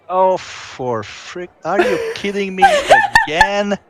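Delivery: high, laughing disbelief; can't believe it, cracking up. Real, not acted. Same winner wins again.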